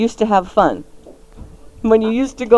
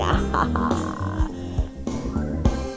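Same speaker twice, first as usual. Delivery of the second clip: deep, soft put-on cackle; quiet, low Halloween chuckle; low spooky laugh; 14 semitones lower, 10 dB quieter than usual. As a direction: laugh low like a mock villain. Spooky Halloween show opening.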